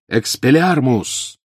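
slow, drawn-out shouted spell. A narrator's reading. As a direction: yell the spell. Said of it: "с криком".

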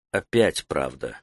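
low, soft, slow musing; inward verdict. A narrator's reading. Taita reads Soe's aura, quiet inward voice. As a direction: murmur it to yourself slowly.

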